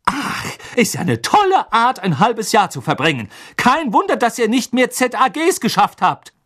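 high, loud scornful retort; sarcastic mother. A narrator's reading. Mother mocking the twins' wasted half year, loud and high. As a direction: sneer it at the twins.

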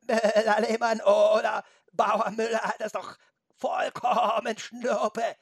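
high, loud, slow goat bleat. A narrator's reading. Goat insists to Felix on its farmer, bleating. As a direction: bleat back with stretched, wobbling vowels. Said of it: "meckerte die Ziege".

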